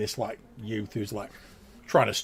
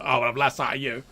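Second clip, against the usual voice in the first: louder, fast scolding guard; gruff rebuke; 3 semitones higher, 6 dB louder, much faster than usual. Acted. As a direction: snap at the lad. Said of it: "and he's like".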